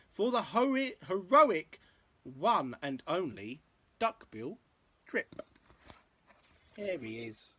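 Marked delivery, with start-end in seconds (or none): 0.1-5.4 lilting, rhythmic picture-book read; story narration. A narrator's reading. Parent reading a dinosaur rhyme to a child, story narration. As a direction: read in bouncy verse rhythm.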